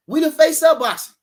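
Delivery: proud emphatic declaration; loud boast. Real, not acted. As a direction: hammer the boast home. Repeating the boast louder.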